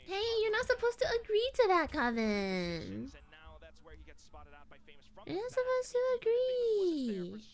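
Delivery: high, drawn-out whine; mock-complaining, vowels held long; playful whiny protest. Real, not acted. Playful protest at the other player.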